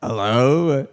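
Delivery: lightly voiced teacher hello slipping out; a hint of the French teacher again instead of the uncle; teacher voice by mistake. Comedian trying his uncle but slipping into the teacher voice. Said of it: "No, that's Mister Wilmacher".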